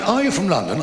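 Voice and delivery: high-pitched, eager female holidaymaker; imitated woman. Voices the woman by the pool.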